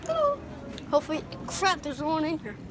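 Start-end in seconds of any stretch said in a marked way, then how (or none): 0.1-0.4 high Elmo squeak; soft squeaky puppet hello. Pretend. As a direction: Elmo impression, half under the breath. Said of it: "I'm doing an Elmo impression".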